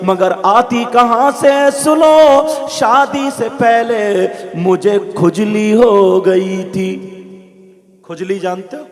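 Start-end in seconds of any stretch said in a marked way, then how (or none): 0.0-7.1 ringing, drawn-out sermon storytelling; chant-like Urdu sermon narration; full-force preaching. Acted. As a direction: preach it out high and loud, stretching phrase endings.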